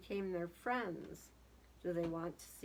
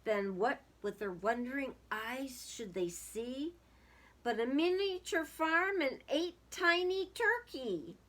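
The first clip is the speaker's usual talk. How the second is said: lively storybook reading aloud; sing-song children's story voice; 3 semitones higher, 4 dB louder than usual. A narrator's reading. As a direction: read it like a rhyming picture book to kids. Reading a picture book aloud.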